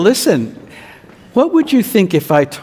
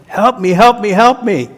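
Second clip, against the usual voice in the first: high, louder begging yell; 7 semitones higher, 5 dB louder than usual. Acted. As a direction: keep yelling for help. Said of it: "he kept yelling it".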